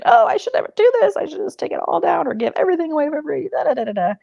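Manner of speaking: replaying her own late-night thoughts, mock despairing; mock despairing inner voice. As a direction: act out the spiral of giving up, half-joking. Retelling her own discouraged thoughts.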